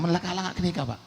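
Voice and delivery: ordinary delivery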